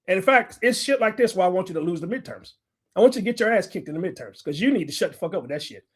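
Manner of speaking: ordinary talk, nothing marked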